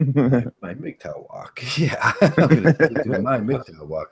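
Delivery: high, slow, laughing through the words; laughing mock echo. Host picking up the friend's tease, laughing. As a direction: echo the joke while cracking up.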